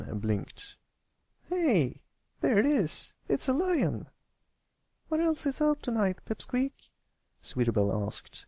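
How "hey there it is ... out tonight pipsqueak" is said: a filly spotting the lion; excited filly. Acted. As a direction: bounce with excitement. Fillies see the lion.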